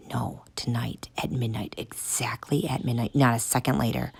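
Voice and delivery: soft furtive whisper. Acted. Tank whispering to the kids, secret whisper. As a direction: keep it hushed.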